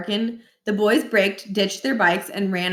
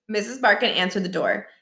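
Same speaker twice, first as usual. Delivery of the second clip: ordinary delivery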